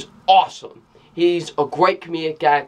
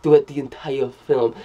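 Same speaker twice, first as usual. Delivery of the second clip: put-on low, slightly subdued Russian accent; put-on Russian accent, kept low; mock Russian accent; 3 semitones lower than usual. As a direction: mimic an actress's bad Russian accent. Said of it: "tried to do this Russian accent".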